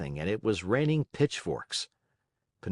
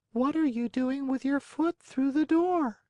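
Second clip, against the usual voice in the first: amused snail. A narrator's reading.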